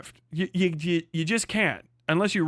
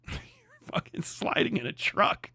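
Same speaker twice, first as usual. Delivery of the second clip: laughing whisper; 5 semitones higher, 6 dB quieter than usual. Real, not acted. Laughing mockery.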